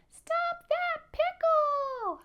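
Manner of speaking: very high, loud, stretched peep; little almond voice. Shown as a demonstration. Showing children the little almond voice, high drawn-out peep. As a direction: squeal it as a tiny almond. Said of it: "in a little almond voice".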